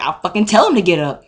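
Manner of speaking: exasperated outburst. Retold or imitated. Student answering the repeated question, exasperated. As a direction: lose patience with a loud jump in the middle. Patience snaps in retold exchange.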